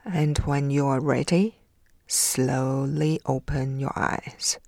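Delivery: calm, soothing breathing guide; guided meditation. Acted. Leads a meditation.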